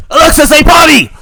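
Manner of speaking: high, loud yell at the device; frustrated high-pitched holler; exasperated shout. Acted. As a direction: lose patience and shout the command. Exasperated dad retries the command.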